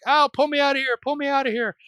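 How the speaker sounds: high-pitched, loud cry for help; mock scream. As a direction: voice a trapped giant wife shrieking to be freed. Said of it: "the wife screams".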